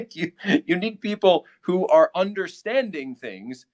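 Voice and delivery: laughing while talking; words break into laughter. Real, not acted. Laughing at a viewer's comment while talking.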